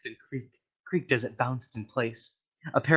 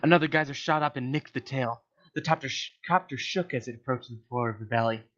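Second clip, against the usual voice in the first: usual speaking voice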